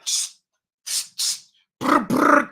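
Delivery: gunfire noises. Retold or imitated. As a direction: mouth gunfire, hissed then buzzed. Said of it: "He was like".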